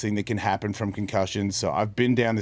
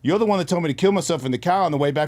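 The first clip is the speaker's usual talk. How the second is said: higher, louder and fast: caught you; accusing the voice, fast and raised; calling it out; 7 semitones higher, 4 dB louder, faster than usual. Retold or imitated. Telling the weed-pushing voice he knows it, raised and fast. Confronting the tempting voice.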